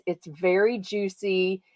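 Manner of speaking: ordinary delivery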